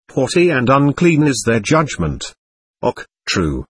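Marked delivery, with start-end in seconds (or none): 2.8-3.7 soft low sigh of agreement. A narrator's reading. Monk sighing his agreement to Father Therapent, softly. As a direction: let it out on a sigh, quieter. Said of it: "sighed the monk".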